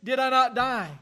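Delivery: mild preacher's cry of Job's despair; understated retold outcry. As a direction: cry it out slightly, as if quoting Job. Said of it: "he cries out".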